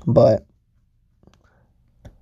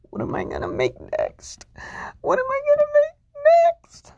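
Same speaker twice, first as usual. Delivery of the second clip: mock crying. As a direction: fake sobbing, voice cracking up high. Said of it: "I'm just kidding".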